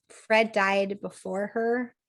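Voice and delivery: ordinary delivery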